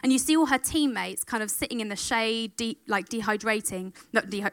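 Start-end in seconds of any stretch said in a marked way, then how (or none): none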